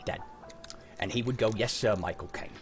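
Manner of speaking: normal talking voice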